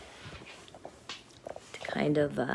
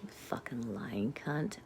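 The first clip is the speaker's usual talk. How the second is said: muttered insult; 4 semitones lower, 8 dB quieter, slower than usual. Real, not acted. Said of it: "I said it".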